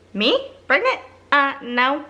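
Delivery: high, rising disbelief; incredulous denial. Retold or imitated. Acting incredulous reply to mom.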